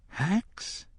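high, soft rising question; bewildered. A narrator's reading. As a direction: think it aloud in hushed disbelief.

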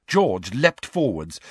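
ordinary delivery, nothing marked

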